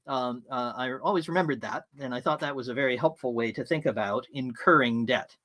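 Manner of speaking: usual speaking voice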